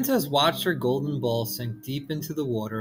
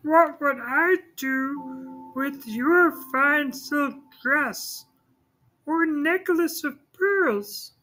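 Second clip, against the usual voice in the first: very high, slow, scornful frog; a frog brushing off silks and pearls; 3 dB louder, slower than usual. Acted. Frog refuses the treasures.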